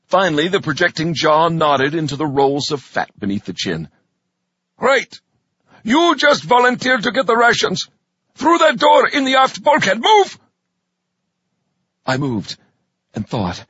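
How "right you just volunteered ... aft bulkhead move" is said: loud barked orders, ending in a shout; drill sergeant. Acted. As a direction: bark the orders, shout the last word.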